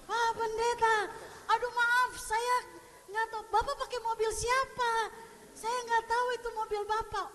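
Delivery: high, fast-tumbling mock grovelling; flustered apology. Driver apologises to the pastor, high and flustered. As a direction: backpedal in a panic. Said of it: "Kata gitu".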